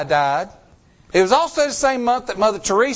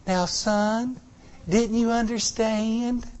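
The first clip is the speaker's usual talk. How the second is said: soft, slow patient dad; a mild fatherly question, softly; gentle father; 3 semitones higher, 6 dB quieter, much slower than usual. Retold or imitated. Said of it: "it wasn't a matter of".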